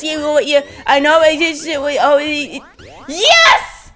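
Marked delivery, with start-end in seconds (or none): 3.1-3.9 triumphant cheer. Real, not acted. Cheers at winning.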